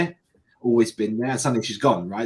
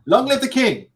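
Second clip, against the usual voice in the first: high, loud hail to the monarch; mock proclamation; 13 semitones higher, 7 dB louder than usual. Host joking about the new monarch, mock royal cheer. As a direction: proclaim it loudly, half laughing.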